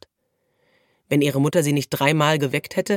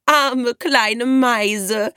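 high, very drawn-out fake pity; jeering, stretched-out taunt; cackling mockery; 7 semitones higher, 4 dB louder, much slower than usual. A narrator's reading. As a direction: mimic it in a sing-song squawk. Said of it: "gackerte Alina".

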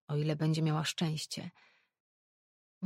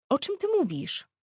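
surprised interruption; 11 semitones higher, 6 dB louder than usual. A narrator's reading. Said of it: "przerwała Ella".

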